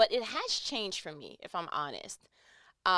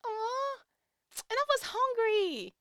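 touched aww; 14 semitones higher, 4 dB louder than usual. Real, not acted. Touched by husband's offer.

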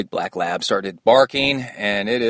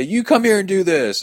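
much higher, mock taunt of a race spectator; 11 semitones higher, 4 dB louder than usual. Spectator taunting the megaphone crew, mock high dare. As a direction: toss back a cheeky dare, voiced up high. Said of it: "and they would say".

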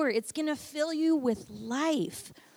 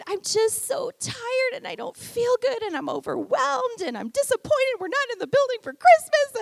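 high, pleading list of woes; mildly tearful unburdening; tearful confession; 9 semitones higher, 4 dB louder than usual. Retold or imitated. Pastor telling husband her troubles, tearful. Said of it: "And I just said".

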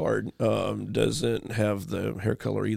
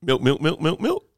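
rapid repeats climbing higher at the end; quick-fire answer; 3 semitones higher than usual. Real, not acted. Answering the trick question about what cows drink, quick-fire repetition. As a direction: rattle the word off in rising runs.

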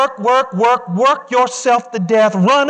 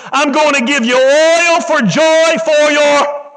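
full-force preaching; 5 semitones higher than usual. Acted. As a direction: stretch each promise out to the crowd. Preaching climax, slow and high.

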